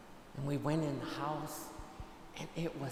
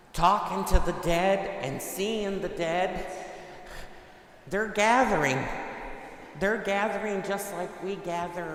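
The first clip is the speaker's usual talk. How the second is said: preacher's climax; emphatic preaching; 3 semitones higher, 6 dB louder than usual. Real, not acted. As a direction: drive it home from the pulpit. Priest preaching with rising force.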